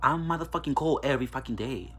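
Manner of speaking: ordinary delivery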